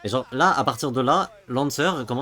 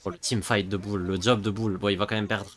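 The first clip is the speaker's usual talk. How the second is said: normal talking voice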